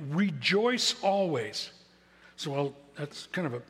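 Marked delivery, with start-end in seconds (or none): 0.1-1.7 slow, firm "Rejoice always." as an order; commanding scripture line. Shown as a demonstration. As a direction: give "Rejoice always." slowly and firmly, as a command. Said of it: "It's a command".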